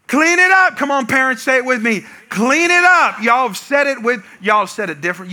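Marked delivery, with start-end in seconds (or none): 0.1-0.8 put-on high, loud yell at the kids; parent's scream. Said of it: "You scream at 'em". 2.3-3.2 put-on high, loud yell at the kids; parent's scream. Shown as a demonstration. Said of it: "You scream at 'em".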